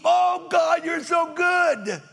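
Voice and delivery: high, loud, drawn-out mock praise; parodying showy altar worship, loud and slow; mock weepy worshipper. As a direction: ham up an overemotional churchgoer. Mocking weepy churchgoers at altar.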